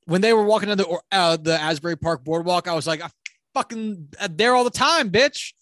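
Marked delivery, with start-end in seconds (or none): 3.0-5.5 high, loud, swaggering brag; cocky Jersey boast. Retold or imitated. Film fan mimicking a Jersey local spotting his boardwalk, loud cocky boast. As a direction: crow it like a proud local.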